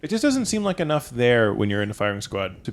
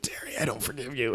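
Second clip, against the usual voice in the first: whispered last gasp; dying whisper; 12 dB quieter than usual. Acted. Dying man whispering to Terry. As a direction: breathe the words out with no voice.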